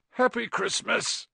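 high, drawn-out sleepy greeting. A narrator's reading. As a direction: stretch it out as if just waking. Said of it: "said Ron sleepily".

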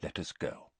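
very soft, low, nearly voiceless; quiet. A narrator's reading. Headmaster leads Harry out of the memory, quiet. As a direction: murmur it as a gentle instruction. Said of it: "said Dumbledore quietly".

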